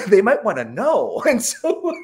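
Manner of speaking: high-pitched, laughing through the words; amused afterthought. Real, not acted. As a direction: break into laughter while talking. Laughs at own belated realization.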